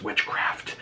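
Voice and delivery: soft breathy whisper. Retold or imitated. Witch answers the teen in a whisper. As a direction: hush the word like a secret.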